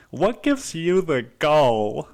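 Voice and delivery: hammed-up impression of a scolding TV counsellor; Doctor Phil. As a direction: confront them like a drawling talk-show host. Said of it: "my Doctor Phil impression".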